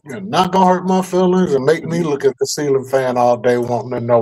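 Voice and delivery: normal talking voice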